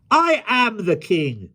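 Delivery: high, slow declaration; insistent royal retort. A narrator's reading. King turns on the man who doubts him, high and slow.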